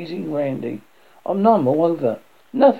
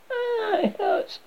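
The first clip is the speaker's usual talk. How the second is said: very high wail of pain; high, thin acted scream; 3 dB quieter than usual. A narrator's reading.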